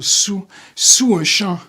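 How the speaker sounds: normal talking voice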